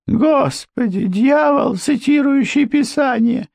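high-pitched appalled exclamation; muttered dismay. A narrator's reading. Wife appalled at the preaching husband, high dismayed aside. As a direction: invoke the Lord in a high, dismayed voice. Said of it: "пробормотала миссис Крент".

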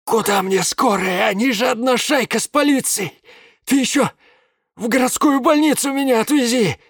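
high, strained furious man; angry drunk man. A narrator's reading. [name] voiced, angry.

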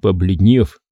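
ordinary delivery

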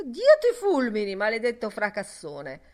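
loud, high outburst easing at the end; exasperated shout over a racket; angry yell. A narrator's reading. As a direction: yell a curse at a noisemaker. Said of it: "urlò mister Dedalus".

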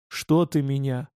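ordinary delivery, nothing marked

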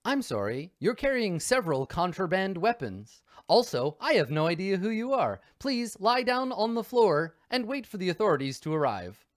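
rising, polite synthetic butler; robot voice. Acted. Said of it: "and he says".

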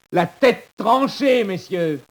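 très aigu, fort et lent; étire « tranchée » en hurlant; cri d'effroi. Acted. (cri étiré et terrifié)

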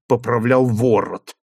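ordinary delivery

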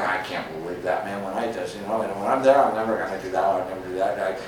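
low, hushed mock grumble; grumbling underling. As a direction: mutter it like a resentful worker griping about the boss. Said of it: "and they're going".

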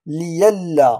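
"ليلا" slow, li-yal-la with y for hamza; إبدال الهمزة ياء. Shown as a demonstration. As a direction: glide through y in "ليلا", no glottal stop. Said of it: "ولا نقول لألا". (نطق "ليلا" بياء مكان الهمزة)

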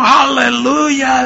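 imitating a content church brother; drawn-out joyful aleluya. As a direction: stretch it out like a happy old brother.

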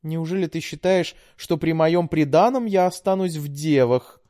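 high, smiling sweet tease; sweetly smiling. A narrator's reading. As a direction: say it with a sweet smile. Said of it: "мило улыбнулась Александра".

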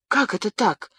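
mild surprise, asking how it can be; a touch of surprised disbelief. A narrator's reading. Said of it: "удивилась Бабетта".

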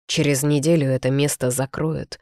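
ordinary delivery, nothing marked

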